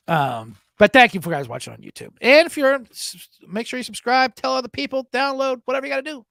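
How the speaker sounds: usual speaking voice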